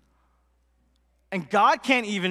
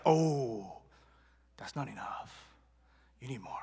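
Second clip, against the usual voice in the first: near-whispered, low, ominous warning; hushed doubt; 10 semitones lower, 10 dB quieter than usual. Retold or imitated. Rice cake seller warning a worried customer, hushed doubt.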